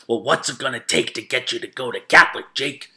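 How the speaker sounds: lightly imitated coach, pressing for an answer; a hint of an aggressive recruiter; pushy coach. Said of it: "he's, like, aggressive at the".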